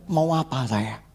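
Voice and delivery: usual speaking voice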